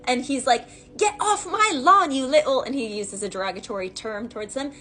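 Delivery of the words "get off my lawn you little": cartoon character with accent. Eskimo to kids on his iced pond, mock accented scolding. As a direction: imitate an irate spoof character's accented yell. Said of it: "He has this accent".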